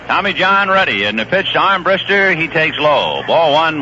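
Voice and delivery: usual speaking voice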